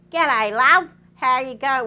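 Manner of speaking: high, loud squawking parrot voice; cockatoo voice. Retold or imitated. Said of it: "And the cocky goes".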